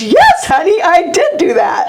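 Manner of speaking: high, emphatic mock-sincere husband, half laughing; coach fibbing to wife. Coach taking credit with his wife, mock sincere. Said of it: "him not skipping a beat".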